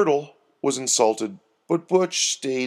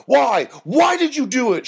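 high, loud, outraged demand; 13 semitones higher, 6 dB louder than usual. A narrator's reading. Doreen pinning Herbie to the wall, demanding. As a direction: cry out for an answer, pitched right up. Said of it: "she demanded".

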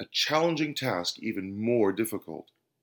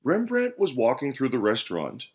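usual speaking voice

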